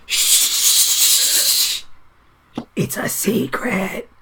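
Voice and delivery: high, soft, secretive shushing; shush, then confide slowly and softly; hushing. Acted. Dwarf hushing tiefling about a secret, secretive hush.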